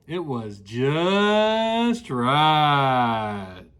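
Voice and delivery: very slow, low and contented; drawn-out satisfied. Acted. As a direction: stretch it out like pure bliss. Goldilocks finds perfect porridge.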